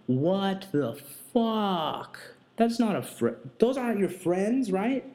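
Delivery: can you believe these people; stunned what the fuck, voice jumping high; incredulous outrage. Real, not acted. Ranting about the drunk girl's friends.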